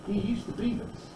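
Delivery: hushed, breathy reminder; soft whisper of a point. Shown as a demonstration. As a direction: whisper it to the class.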